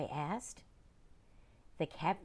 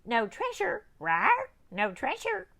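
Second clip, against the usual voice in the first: high, loud parrot squawk; high parrot screech; 13 semitones higher, 6 dB louder than usual. Acted.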